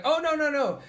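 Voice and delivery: high, eager protest in the neighbor's voice; mock neighbor. Retelling the neighbor's eager denial about the dog, high-pitched. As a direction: play the neighbor brushing it off brightly. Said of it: "And he's like".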